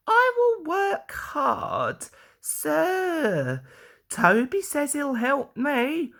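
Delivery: eager to please the boss; Daisy. A narrator's reading. Daisy promising.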